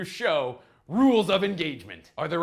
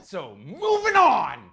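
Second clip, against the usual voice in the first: loud slow announce; 6 dB louder, slower than usual. Acted. Loud transition.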